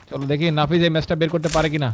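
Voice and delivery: ordinary delivery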